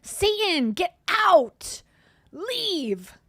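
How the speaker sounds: a pretend exorcist commanding; mock exorcism. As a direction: cast out Satan in a hammed-up shout. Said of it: "do some light language to remove".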